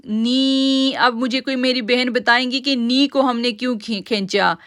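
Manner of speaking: ordinary talk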